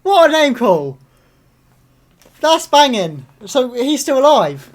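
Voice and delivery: loud, high-pitched fan delight; real excited outburst. Real, not acted. Fan learning Quinlan Voss still lives, loud excited outburst. Hears a favourite character named.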